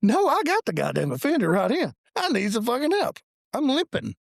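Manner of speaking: high-pitched, exasperated mock urgency; retold plea for help.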